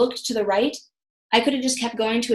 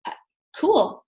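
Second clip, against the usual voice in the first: awkward okay; 7 semitones higher, slower than usual. Retold or imitated. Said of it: "I'm like".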